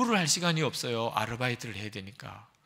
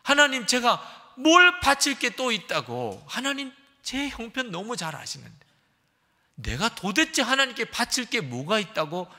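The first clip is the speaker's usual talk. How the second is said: angry protest; 9 semitones higher, 7 dB louder than usual. Retold or imitated. Upset student arguing with God, loud and indignant. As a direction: hurl the complaint back at God. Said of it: "막 화가 나더라는 거예요".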